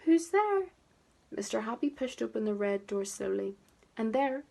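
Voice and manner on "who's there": high squeaky repeat, a little louder; the small sad voice asks again. A narrator's reading. Someone behind the red door asks again, squeaky and sad. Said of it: "a sad, squeaky sort of voice".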